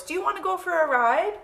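high, loud horse character inviting; storybook horse. Acted. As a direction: ask eagerly as a high-voiced horse. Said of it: "said the horse".

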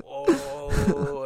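mock surprise.

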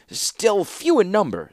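put-on high fretful old man voice; Rusko's voice. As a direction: pitch up high as an anxious old clockmaker. Rusko speaks.

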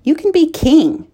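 high, urgent plea, voice lifted; a hopeful cry to the knight; pleading high. A narrator's reading. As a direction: press him, pitch climbing. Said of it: "she cried".

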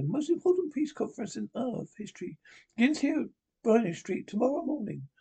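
high, prim and imperious; Margaret Thatcher impression. Prime Minister scolding the Doctor, high and prim. Reader voices Miss Thatcher's lines.

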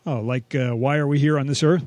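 ordinary talk

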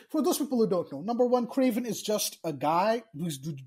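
ordinary delivery

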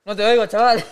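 loud over loud music in headphones; calling out without hearing himself. Real, not acted. Shouting while wearing loud headphones.

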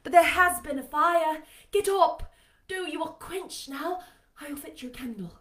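high-pitched urging; urgent Jane character voice. A narrator's reading. Jane urges Rochester out of bed.

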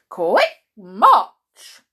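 loud sergeant-style marching order; drill command. A narrator's reading. Boots setting off again, loud drill command. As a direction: call out the march.

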